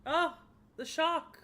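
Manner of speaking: loud, high dramatic gasping words; theatrical shock. Acted. Onlooker reacts to the goddess's true form, loud high theatrical shock. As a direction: overplay the astonishment. Feigned or staged shock at reveal.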